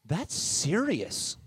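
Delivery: slow, drawn-out imitation of a child; imitated daughter. Daughter telling dad about baptism, imitated earnest child. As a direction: stretch it out like an earnest kid. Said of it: "And she goes".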